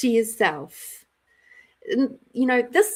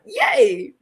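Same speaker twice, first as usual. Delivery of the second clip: mock cheer; 10 semitones higher, 5 dB louder than usual. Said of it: "And I'm like".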